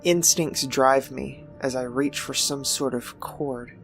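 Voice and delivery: ordinary delivery, nothing marked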